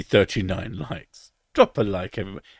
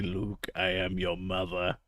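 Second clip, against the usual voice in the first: Darth Vader impression; 4 semitones lower, 11 dB quieter, slower than usual.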